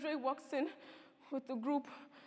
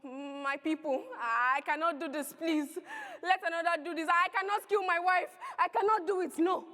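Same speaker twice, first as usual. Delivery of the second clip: rising loud begging; desperate pleading; 4 semitones higher, 6 dB louder than usual. Acted. Father pleading with the mob, desperate. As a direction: beg for mercy.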